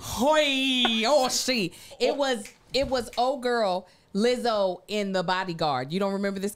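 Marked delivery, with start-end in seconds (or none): 0.0-1.7 higher, put-on Aussie call; Aussie accent. Shown as a demonstration. Co-host correcting British to Australian, mock Aussie accent. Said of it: "Shrimp on the Barbie is Aussie".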